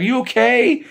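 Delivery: put-on high, worried girl's voice; worried Chile. Chile checking on fallen Broly, high worried voice. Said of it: "She's like".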